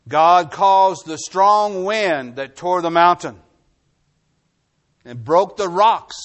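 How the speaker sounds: slow, loud, emphatic preaching; full-force preaching. Acted. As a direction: pound each line home from the pulpit. Preacher hammering home a point.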